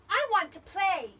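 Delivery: high, loud little character voice; eager Little Bear. A narrator's reading. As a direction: pipe up wanting to play.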